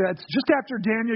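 usual speaking voice

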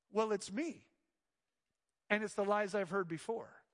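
usual speaking voice

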